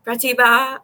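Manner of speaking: imitating Ronnie saying the name; Ronnie's way. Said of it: "just like how Ronnie would say my name".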